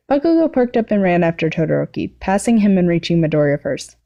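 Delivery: usual speaking voice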